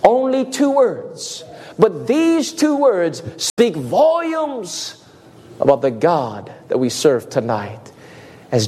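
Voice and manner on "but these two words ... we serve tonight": normal talking voice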